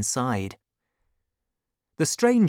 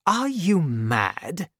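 slow, higher-pitched disbelief; incredulous; 5 semitones higher, slower than usual. Acted. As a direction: draw the question out, taken aback. Stranger astonished at the threat.

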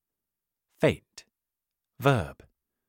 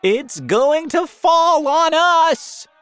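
slow, high, drawn-out scream of alarm; panicked cry; 10 dB louder, slower than usual. A narrator's reading. Crowd below the falling peach, panicked cry. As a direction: yell it out in terror, stretching words. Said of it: "they cried".

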